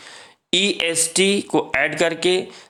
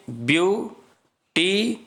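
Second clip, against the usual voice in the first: Beautiful split into three separate syllables; teacher counting out the syllables of beautiful; 4 semitones higher, much slower than usual. Shown as a demonstration. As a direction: break beautiful into view, टी, फुल with clear pauses. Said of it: "तो एक syllable आपका ये हो गया".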